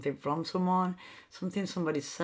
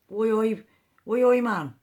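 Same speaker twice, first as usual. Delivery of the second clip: loud mock Geordie oi oi, higher; mock Geordie call; 5 semitones higher, 7 dB louder than usual. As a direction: shout oi oi like a cheeky northern lad. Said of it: "I don't know if that's Brummie".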